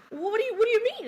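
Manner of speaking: high-pitched, stammering mock confusion; retold bewilderment. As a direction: act thrown by the news. Retold confusion when told she's pushing.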